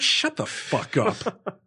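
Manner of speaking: high, slow, drawn-out retort; mock angry retort. Patient answering a scolding nurse, mock angry retort. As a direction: stretch out an exasperated comeback.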